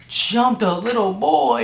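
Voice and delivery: high, slow outraged echo; shocked disbelief. Retold or imitated. Son hears the principal's accusation, outraged high disbelief. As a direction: repeat it in stunned outrage.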